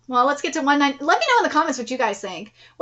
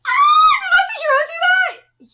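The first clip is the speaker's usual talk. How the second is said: high, loud crying outburst; tearful wail; 5 dB louder than usual. Real, not acted. Reader moved by the grandmother scene, loud tearful wail.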